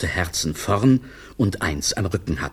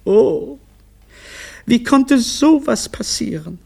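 very high, loud dismay; high-pitched stunned wife; aghast; 5 dB louder than usual. Acted. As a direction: wail in utter disbelief. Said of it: "fragte Frau Olivia fassungslos".

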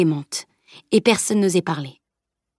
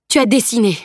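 worried; 5 semitones higher, 4 dB louder than usual. Acted. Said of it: "s'inquiéta-t-il".

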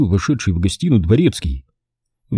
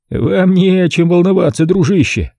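higher, friendly reassuring host; affectionate reassurance; 9 semitones higher than usual. A narrator's reading. Stanton reassuring his worried guest, friendly. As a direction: soothe him like an old friend. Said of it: "ласковым голосом заверил Стентон".

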